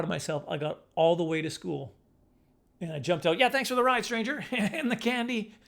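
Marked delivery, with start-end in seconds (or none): 3.4-5.5 cheery thanks. Retold or imitated. Retold farewell to driver.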